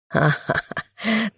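slow, sneering villain laugh; mocking laugh. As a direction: gloat over the failed change. Villain mocks the bear-man.

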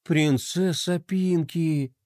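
slow, dreamy drawn-out name; spellbound drawl. Acted. Smitten skater repeating Pinky's name, spellbound drawl. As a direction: stretch the name out, enchanted. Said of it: "завороженно протянул Флай".

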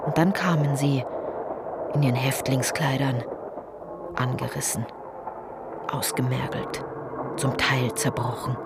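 hushed narration. Acted. Returning camp prisoners, hushed.

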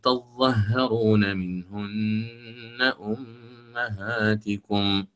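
slow chanted recitation, ya held long; تلاوة مع إشباع المد; Quranic recitation with full madd. Shown as a demonstration. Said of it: "فأعيد الأوجه".

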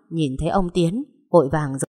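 ordinary delivery, nothing marked

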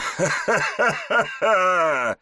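high mocking villain laugh; evil laugh. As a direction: cackle with contempt. Villain mocking after insult.

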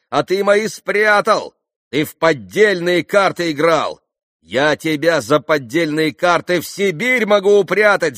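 high, loud, indignant tirade; angry accusation. A narrator's reading. Landlord accuses pan of marked cards, angrily. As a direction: hurl the accusations at the cheat.

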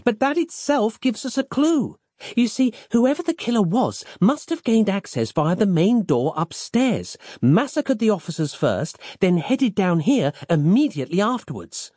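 put-on high, eager young woman voice; Roz voice.

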